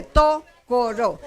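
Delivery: Tokoro slowly, split after a stressed TO; TO... koro, slow demonstration of first-syllable stress. Shown as a demonstration. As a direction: draw out "Tokoro" syllable by syllable, leaning on TO. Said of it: "debo acentuar la primera".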